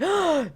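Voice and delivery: high sharp gasp; shocked gasp. Retold or imitated. Mabel and Candy seeing the kiss, high shocked gasp.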